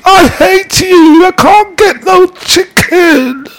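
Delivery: put-on shrill high wailing child shout, slowed; hammed-up crying kid yelling at her parents. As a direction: imitate a little girl howling for chicken. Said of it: "She's crying, she's going".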